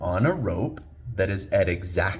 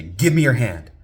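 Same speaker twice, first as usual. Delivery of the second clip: firm, louder, higher parental command; very stern; 4 semitones higher, 8 dB louder than usual. Retold or imitated. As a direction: order the child sternly and urgently. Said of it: "I very sternly, I say".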